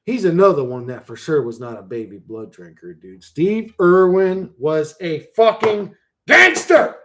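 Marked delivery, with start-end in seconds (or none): 3.2-6.9 loud, slow, rising to a shout; emphatic declaration. Pretend. Streamer championing Steve Irwin, slow loud proclamation. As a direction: spell it out word by word, building.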